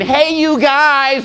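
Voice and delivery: high, slow, drawn-out chirpy greeting; mock the lame chipper server; mocking a server's bland greeting. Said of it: "That's so freaking lame".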